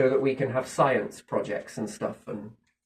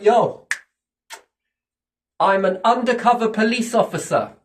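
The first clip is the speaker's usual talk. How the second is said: loud, higher-pitched 'yo' then the reveal; mock bold announcement; 6 semitones higher, 5 dB louder than usual. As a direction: blurt it out like a hopelessly indiscreet cop. Mock undercover cop announcing himself.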